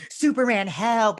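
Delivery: high, loud damsel-style call; mock cry for help. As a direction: call out to a rescuer, hammed-up. Acting out call for rescue.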